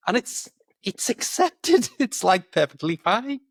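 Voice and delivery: ordinary talk, nothing marked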